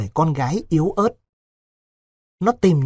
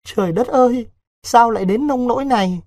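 sobbing lament; 7 semitones higher than usual. A narrator's reading. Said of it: "bưng mặt khóc nức nở".

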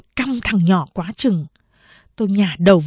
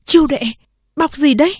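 a touch of alarm, pitched higher; frightened question; 7 semitones higher, 4 dB louder, faster than usual. Acted. Mother asking daughter about the bundle, mildly alarmed. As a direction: ask fearfully about the bundle. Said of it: "Mẹ tôi hoảng sợ hỏi".

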